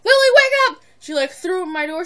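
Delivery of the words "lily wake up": loud high wake-up call; bursting in to wake a friend, loud and excited; excited yell. Retold or imitated. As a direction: shout her awake with glee. Friend bursts in waking her.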